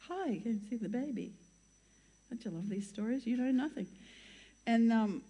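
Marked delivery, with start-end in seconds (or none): 0.1-1.3 softly voiced spaced-out visitor; off their chops. Stoned friends arriving to see the baby, lightly imitated off-their-chops greeting. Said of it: "they were off their chops".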